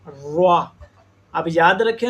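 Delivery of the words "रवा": रवा with rounded lips and w-glide; रवा, lips pushed round; wrong rounded ra. Shown as a demonstration. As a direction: lower रवा with a w after r. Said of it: "वो पढ़ते हैं".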